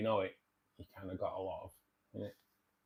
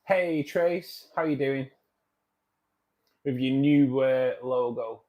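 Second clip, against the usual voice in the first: loud, higher-pitched hello; hearty greeting; 4 semitones higher, 11 dB louder, slower than usual. Real, not acted. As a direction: call out to a friend who just turned up. Calls out greeting to arriving viewer.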